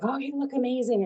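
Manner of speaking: gushing compliment. Retold or imitated. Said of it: "it's like".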